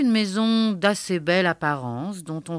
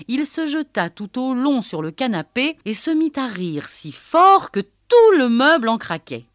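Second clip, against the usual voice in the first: usual speaking voice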